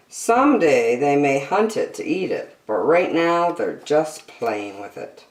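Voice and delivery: bright, lilting picture-book read-aloud; reading to children. Acted. Reading an animal picture book to young children, bright and lilting. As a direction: read it like a bedtime nature book for kids.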